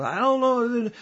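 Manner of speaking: hammed-up Italian, rushing the order; mock Italian accent. ISIS handler pushes a recruit to speak on camera, in a mock Italian accent. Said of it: "sound Italian".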